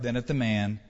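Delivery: ordinary talk, nothing marked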